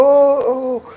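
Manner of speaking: sudden high surprised "Oh". Real, not acted. Teacher spotting a new question from a student, surprised. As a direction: catch it with a jump of surprise.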